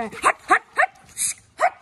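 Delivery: loud, high shouts of hut; shooing shout. Real, not acted. Owner shooing two fighting cats away, loud shouts. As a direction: shoo the cats off with sharp loud yells.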